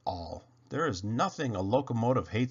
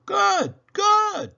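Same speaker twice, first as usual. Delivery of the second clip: very high, loud praise; old engineer praising the engine; delighted old engineer; 9 dB louder than usual. Acted. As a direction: cheer him on. Bill praises.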